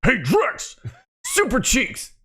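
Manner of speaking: high, swooping character call; Abby Butch voice. Acted. Calling to a co-host on a chat request, high character voice. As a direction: call out teasing pet names in a high put-on voice. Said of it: "in Abby Butch voice".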